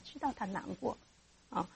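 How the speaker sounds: normal talking voice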